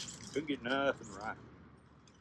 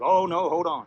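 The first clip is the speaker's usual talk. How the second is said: loud, high-pitched alarm; acted alarm, voice thrown up; urgent warning; 8 semitones higher, 14 dB louder, slower than usual. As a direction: stop a friend in a hurry. Warns Rabbit off the man.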